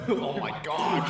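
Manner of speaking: loud, high, helpless laughter; real laughing exclamation. Real, not acted. Game master cracking up at the democracy idea, laughing loudly. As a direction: cry out while cracking up.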